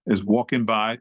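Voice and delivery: ordinary delivery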